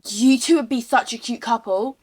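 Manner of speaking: loud mock matchmaking exclamation; retold loud teasing. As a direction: announce it loudly to tease the pair. Retold teasing about a couple.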